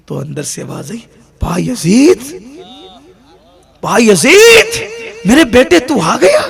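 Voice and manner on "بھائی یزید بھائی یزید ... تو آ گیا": mother calling out. Acted. Mother calling through the door to her returning son, loud and high. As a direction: call him through the door, overjoyed.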